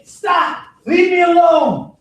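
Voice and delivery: loud, high yell; firm shouted stop; shouted command. Shown as a demonstration. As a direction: shout it with full force. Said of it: "I say".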